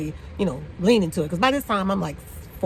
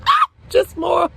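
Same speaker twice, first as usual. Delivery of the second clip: high, loud burst of frustration; fed-up yell; exasperated shout; 10 semitones higher, 5 dB louder, much slower than usual. Real, not acted. Exasperated about clients knowing.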